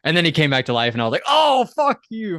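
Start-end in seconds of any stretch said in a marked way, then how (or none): 1.3-2.4 mock outrage. Retold annoyed reaction.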